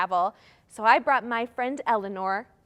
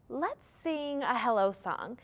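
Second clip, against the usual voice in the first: ordinary delivery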